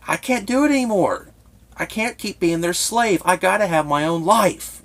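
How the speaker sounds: high, loud voice near breaking as he pleads; tearful plea. Retold or imitated. Man pleading with caseworker in tears, high pitched. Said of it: "I just break down in tears".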